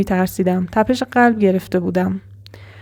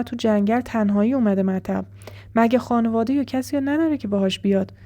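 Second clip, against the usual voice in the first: softer, tense storytelling for the scary part; hushed horror narration; 4 dB quieter than usual. A narrator's reading. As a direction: draw the listener in, quietly.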